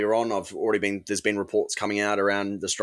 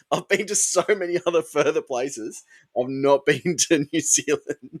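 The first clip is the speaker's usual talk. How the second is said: higher-pitched, laughing through the words; amused, pitched-up confession; laughing admission; 6 semitones higher than usual. Real, not acted. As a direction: own up with a laugh breaking in. Laughing admission after co-host's shock.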